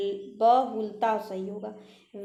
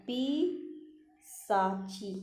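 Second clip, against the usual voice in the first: पिशाची drawn out slowly and softly; पिशाची dictated very slowly; very slow dictation; 4 dB quieter, much slower than usual. Shown as a demonstration. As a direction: stretch पिशाची while writing it. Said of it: "पिशाची होता है".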